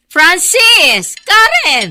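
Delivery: loud call across the house. Acted. Mother calling her late children, loud call. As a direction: shout their names to wake them.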